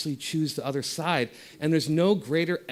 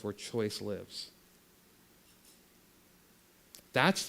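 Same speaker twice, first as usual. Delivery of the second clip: gentle guided visualization, voice softer on each line; guided meditation; 3 semitones lower, 5 dB quieter than usual. Acted. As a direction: ease them into stillness, barely above a breath.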